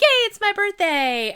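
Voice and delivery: put-on high, loud childlike squeal of yay; five-year-old. Birthday girl cheering like a small child, high and loud. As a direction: gush like a little kid on her birthday. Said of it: "turn me into a five-year-old".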